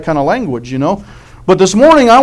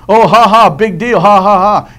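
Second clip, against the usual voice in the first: mocking laugh; 3 semitones higher, 5 dB louder than usual. Mocking someone who trips.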